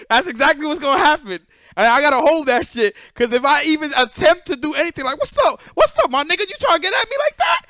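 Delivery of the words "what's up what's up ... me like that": high, loud, hammed-up challenge; mock street challenge. Picturing a fight with the dad, a high loud mock challenge.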